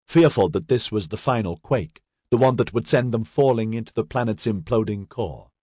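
usual speaking voice

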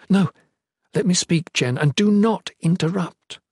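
queen forbidding interruption; insistent. Acted. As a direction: demand to be heard out. Queen cuts off guard's interruption.

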